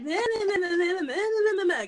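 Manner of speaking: high nagging meh-meh chatter; mock the assistant rambling on; mocking babble. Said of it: "She's like".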